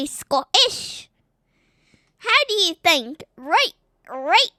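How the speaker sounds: drawn-out, put-on Scottish accent. Child answering dad's request, Scottish accent. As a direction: lean hard into a playful Scottish brogue. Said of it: "do Scottish?".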